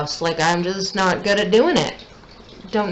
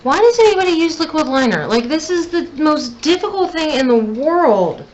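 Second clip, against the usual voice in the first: frustrated outburst; 7 semitones higher than usual. Real, not acted. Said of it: "I'm getting really frustrated".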